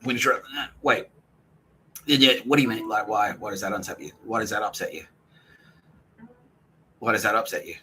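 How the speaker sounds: usual speaking voice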